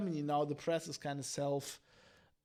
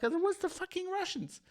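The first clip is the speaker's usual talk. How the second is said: much higher mock announcement of blame; mimicking officials, pitched way up; 12 semitones higher than usual. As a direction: parody a confident government claim. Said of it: "they go like".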